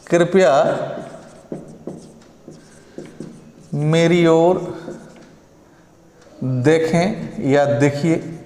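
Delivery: slow, gentle pleading tone; polite request. Shown as a demonstration. Teacher asks students to look at him kindly, slow pleading. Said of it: "थोड़ा आग्रह कर दो यार".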